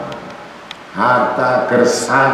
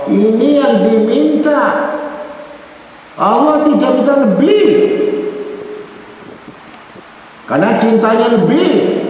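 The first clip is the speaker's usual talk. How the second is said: high, fervent sermon exhortation; a preacher urging believers on, in a high, fervent voice; impassioned preaching; 11 semitones higher than usual. Acted. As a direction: plead with the flock, soaring up.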